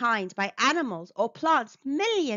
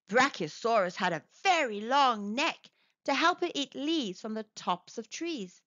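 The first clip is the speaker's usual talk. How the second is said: ordinary delivery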